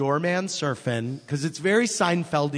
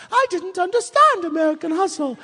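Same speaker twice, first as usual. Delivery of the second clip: high, put-on older lady voice; high mock Barbara Walters line; Barbara Walters impression; 6 dB louder than usual. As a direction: mimic her prim complaint.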